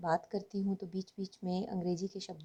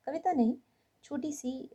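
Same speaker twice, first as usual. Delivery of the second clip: usual speaking voice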